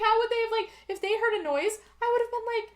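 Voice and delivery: ordinary talk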